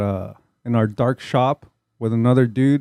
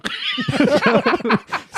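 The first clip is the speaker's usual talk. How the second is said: laugh; 11 semitones higher than usual. Real, not acted. Laughing at own quote.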